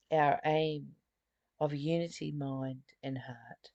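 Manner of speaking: ordinary talk, nothing marked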